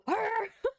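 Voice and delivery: high, loud burst of laughter; shrieking laugh. Real, not acted. Laughing at her own mockery, high shrieking laugh. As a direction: crack up at your own joke. Cracking up after the mock.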